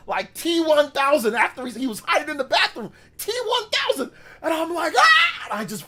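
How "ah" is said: high, loud mock scream; scared yell. Kid screaming as the chaser charges, high and loud. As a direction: yelp at the attacker.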